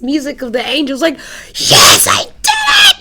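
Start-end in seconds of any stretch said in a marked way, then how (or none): 1.5-3.0 loud, much higher shout of victory; triumphant shout. Retold or imitated. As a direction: yell it like you just made it. Triumph at reaching her hero.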